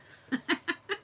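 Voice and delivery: high rising giggle; a brief amused laugh; light laugh. Real, not acted. Laughing at her own remark.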